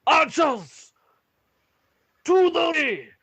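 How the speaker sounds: very high, loud commander's yell; full-force military shout; shouted battle order. Acted. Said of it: "and shouts".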